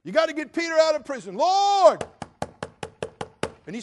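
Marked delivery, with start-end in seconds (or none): 0.0-2.0 much higher and louder, fast desperate pleading; hammed-up panicked prayer, shouting to the Lord; frantic loud prayer. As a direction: beg God at the top of your voice.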